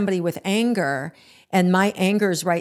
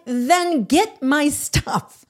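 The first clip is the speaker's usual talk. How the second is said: high, drawn-out exasperated demand; retold anger; 7 semitones higher, much slower than usual. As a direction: stretch each word in re-enacted fury. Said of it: "like, at a nine".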